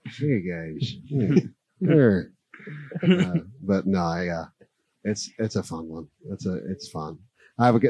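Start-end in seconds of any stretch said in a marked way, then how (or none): 0.1-2.3 lightly voiced drowsy old politician; a hint of a mumbling statesman; Sleepy Joe impression. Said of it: "I like to do Sleepy Joe".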